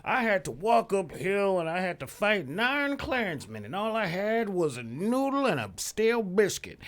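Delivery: put-on high, loud cranky old-timer rant; old dudes. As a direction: grumble like a grumpy old geezer. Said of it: "I don't wanna sound like one of those old dudes".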